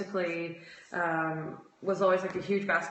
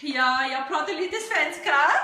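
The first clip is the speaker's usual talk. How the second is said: raised, slowed sing-song Swedish phrase; Swedish put-on voice; 7 semitones higher, 6 dB louder than usual. Real, not acted. Proudly showing off her Swedish to viewers, in a higher, slower sing-song. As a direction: Swedish lilting, pitched up and drawn out. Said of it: "I don't know why I always talk like that".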